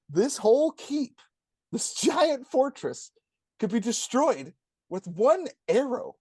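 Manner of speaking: ridiculing a fortress felled by one arrow; incredulous mockery. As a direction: drag it out in mock amazement. Mocking the show's fortress.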